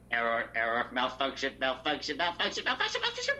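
malfunctioning robot, voice spiralling up; toy robot voice. Acted. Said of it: "if we held these voices the entire time".

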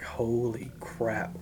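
soft, drawn-out amazement; hushed disbelief. Retold or imitated. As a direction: let it out slowly, under your breath. Retelling stunned reaction to offer.

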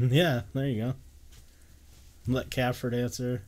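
ordinary talk

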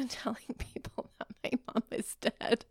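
hushed giggly whisper. Real, not acted. Listing her unpopular opinions, guilty whisper. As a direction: confess it under your breath.

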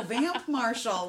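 half-yelled imitation of a call; mock yell. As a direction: call it out slowly, loud. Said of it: "Like how I yell at you".

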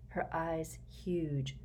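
ordinary delivery, nothing marked

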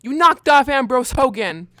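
loud, hard accusation; angry accusation. Acted. Cop roars the murder charge at the suspect. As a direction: slam the charge at him.

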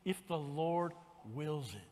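soft, low and slow; a hushed, humble If the Lord wills it. Shown as a demonstration. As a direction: lay it down gently and slowly. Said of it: "When you're talking about things".

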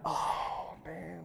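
breathy whispered dismay; caught-out whisper. Retold or imitated. Believer caught out at judgment, whispering dismay. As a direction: whisper it like you've been caught. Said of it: "And you're like".

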